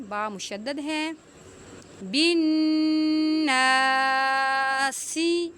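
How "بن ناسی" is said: الناس with a long نا and a hissing س; whistled seen. Shown as a demonstration. Teacher stretching الناس for students, slow and hissing. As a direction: hold the نا, then let the س whistle. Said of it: "سین میں سیٹی کی آواز نکالیں گے".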